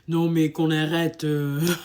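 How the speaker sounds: French exasperation, tongue in cheek; dismissive French. Retold or imitated. French speaker brushing off complaints.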